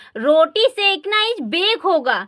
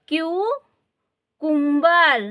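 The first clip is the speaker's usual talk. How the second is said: slow model word; much slower than usual. Shown as a demonstration. Teacher modelling a new word.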